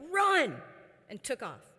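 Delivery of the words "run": sudden loud high shout of alarm; yelled Run. Retold or imitated. Husband warns of angry swarming bees, yelling loud and high. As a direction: bark it out as the bees attack. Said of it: "Chris yelled".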